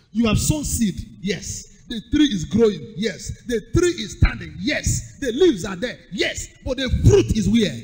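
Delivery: loud rhythmic preaching call; loud sermon cadence with answered yes; preaching at full force. Acted. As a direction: drive each line home to the crowd.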